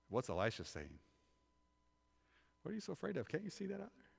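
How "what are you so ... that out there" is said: high, soft incredulous questioning; lightly acted disbelief, pitched up; puzzled prophet. Prophet asking his servant what scares him, softly incredulous.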